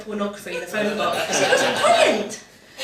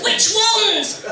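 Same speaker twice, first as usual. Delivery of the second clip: puzzled Cornishman; 10 semitones higher, 5 dB louder than usual. Retold or imitated. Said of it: "He goes".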